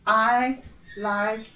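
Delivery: very slow, every word clear; slow drill. Shown as a demonstration. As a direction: spell out each question slowly for students. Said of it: "Mais uma vez". (pergunta lenta e bem articulada)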